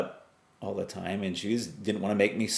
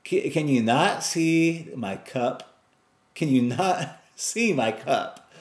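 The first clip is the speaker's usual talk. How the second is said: loud, much higher Really, then slow pleading question; exasperated plea upward; 7 semitones higher, 3 dB louder, slower than usual. Retold or imitated. Man looking up at the sky, loud then slow exasperation. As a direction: complain to the sky, slow and fed up. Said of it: "looking up".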